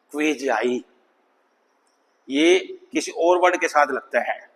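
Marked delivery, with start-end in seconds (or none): 0.1-0.8 "क्वेजाई" said as kway-zai, lower; pronunciation model. Shown as a demonstration. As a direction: model "क्वेजाई" with a W glide and long A. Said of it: "इसकी pronunciation है".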